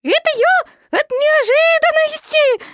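very high, loud, drawn-out quaver; startled tiny piglet, voice trembling. A narrator's reading. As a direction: squeak it out shakily from shock. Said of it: "сказал Пятачок дрожащим голосом".

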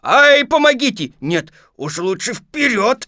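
shrieked plea, high and loud; panicked cry. Acted. Pooh stuck and changing his mind, crying out. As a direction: burst out high, then ease slightly. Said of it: "Ещё потом он закричал".

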